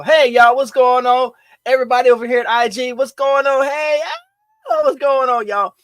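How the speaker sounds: high, loud hype greeting; pumped-up streamer hello; excited greeting. Real, not acted. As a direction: call out to the viewers with energy. Greeting live viewers.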